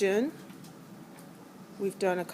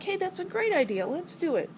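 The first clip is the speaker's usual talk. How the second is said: much higher, bright agreement; new hydrogen eagerly accepting the offer, much higher; 9 semitones higher than usual. Acted. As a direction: jump at the idea happily.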